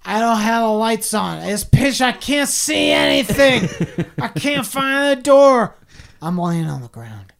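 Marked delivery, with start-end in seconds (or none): 0.0-5.8 put-on laughing drunk quote. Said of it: "And you're like".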